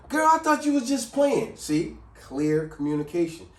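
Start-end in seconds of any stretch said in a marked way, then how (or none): 0.1-1.6 high, loud sassy excuse; mock excuse. Imitating the friend dodging the bill, high and loud. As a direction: play the friend wriggling out of paying.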